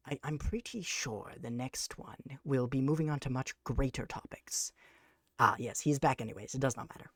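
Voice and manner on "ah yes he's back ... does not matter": ordinary talk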